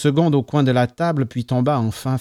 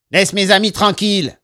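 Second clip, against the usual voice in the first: nasal warning, higher and louder; raised nasal protest; 6 semitones higher, 5 dB louder than usual. A narrator's reading. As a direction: tell him off through the nose. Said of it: "toujours aussi nasillard".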